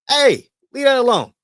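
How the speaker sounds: high, loud retold telling-off; scolding the dog. Owner to his dog near the Frito bag, loud scolding. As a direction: call out sharply to stop a pet.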